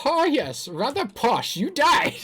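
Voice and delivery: high, hammed-up posh British accent; swooping mock-posh British toff; badly faked British. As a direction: exaggerate a fake British accent, pitched high. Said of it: "That's not British".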